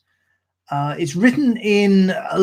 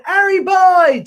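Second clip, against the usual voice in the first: shout; 8 dB louder, slower than usual. Acted. Soldiers shouting in poem.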